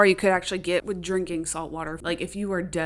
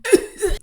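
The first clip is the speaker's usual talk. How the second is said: mock cough; 13 semitones higher, 7 dB louder than usual. Peeta waking up.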